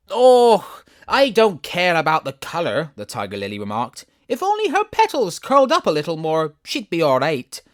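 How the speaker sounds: high, loud dismissive flower; proud tall lily, disdainful; tiger lily, scornful. Acted. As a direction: sniff at the girl's petals.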